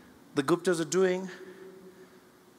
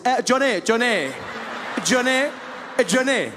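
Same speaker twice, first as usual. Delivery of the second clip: high, loud repeated call; 8 semitones higher, 4 dB louder than usual. Retold or imitated. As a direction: summon him across the restaurant again and again. Calling the waiter over repeatedly.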